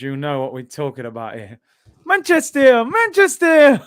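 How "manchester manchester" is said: high, loud mock chant of the town name; In-Betweeners quote. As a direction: belt it out like the film character. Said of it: "For anyone who watches In-Betweeners".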